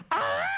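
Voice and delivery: sudden shrieking high moan; loud sudden moan. Pretend. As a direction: blurt out a wild sex yelp. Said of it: "you go".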